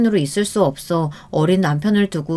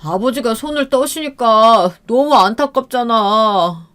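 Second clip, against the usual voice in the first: 울먹이는 남편; 3 semitones higher than usual. Retold or imitated. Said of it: "말을 하면서 갑자기 우는".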